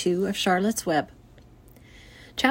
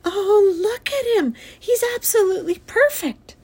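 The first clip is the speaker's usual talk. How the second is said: put-on high, adoring little-girl voice; pitched-up, thrilled wonder; whispered delight; 13 semitones higher, 4 dB louder than usual. A narrator's reading. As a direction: marvel over it with delight. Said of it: "she whispered".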